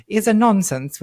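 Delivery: English accent. Shown as a demonstration. Host showing why the quote needs English accent. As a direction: show the phrase in an English accent. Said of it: "without doing it in an English accent".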